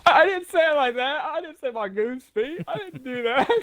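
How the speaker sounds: high-pitched indignant denial; squealed mock-offended denial; protesting. Real, not acted. Indignant denial of mocked pronunciation.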